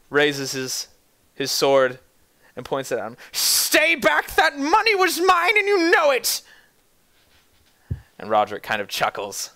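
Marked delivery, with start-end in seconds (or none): 3.3-6.4 put-on high, cracking, panicky old-man cry; much higher, scared, defiant old man; frightened old man. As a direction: wave the sword and shriek it. Feeble old man brandishing sword.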